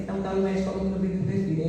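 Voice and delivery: usual speaking voice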